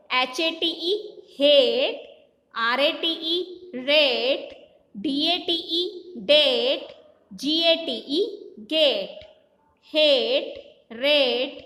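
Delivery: slow spelling chant, each word stretched; phonic drill. Shown as a demonstration. Teacher drilling children in phonics, measured chant. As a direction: spell it out in a measured sing-song, last word falling.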